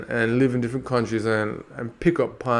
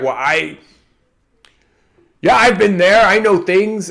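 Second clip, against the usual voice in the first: higher, louder smug been-there voice; mock know-it-all; 5 semitones higher, 5 dB louder than usual. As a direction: play the self-important traveller. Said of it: "the new tendency is this race to be like".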